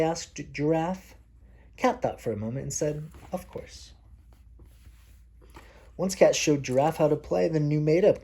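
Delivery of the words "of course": soft whispered reply. Acted. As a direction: breathe the answer gently. Cat answers in the story.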